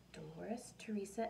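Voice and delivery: usual speaking voice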